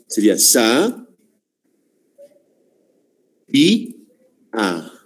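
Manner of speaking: sa, í, a spelled out syllable by syllable; syllable split. Shown as a demonstration. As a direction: spell out sabía in pieces. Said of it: "Cómo se en sílabas".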